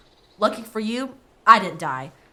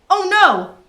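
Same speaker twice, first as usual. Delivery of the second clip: frightened cry; 11 semitones higher, 12 dB louder than usual. Acted. Boy slipping into a well.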